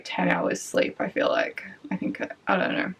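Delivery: fading to a tired murmur; trailing off quietly. Real, not acted. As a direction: drop into a near whisper.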